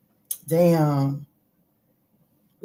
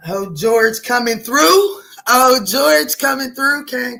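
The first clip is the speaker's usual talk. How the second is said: excited announcement; 4 semitones higher, 7 dB louder, slower than usual. Real, not acted. Spirit message arrives.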